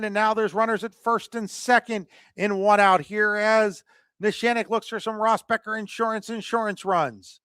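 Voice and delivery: ordinary delivery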